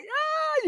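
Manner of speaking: high squeal of a roller coaster scream, thin and breathy; mock scream. Coach acting a rider caught mid-dip, mock squeal. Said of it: "You could be like".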